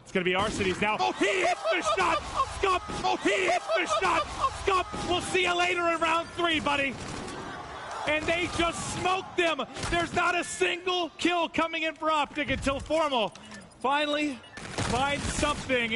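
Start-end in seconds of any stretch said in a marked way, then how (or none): 1.0-2.8 excited play call. Real, not acted. Live kill call. 3.0-4.2 excited play call. Real, not acted. Live kill call. 4.6-15.9 high energy play-by-play call; esports caster. Acted.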